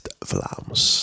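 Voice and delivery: low breathless whisper. Real, not acted. Podcaster names the one accent he hates, whispered. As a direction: drop to a whisper in mock disgust.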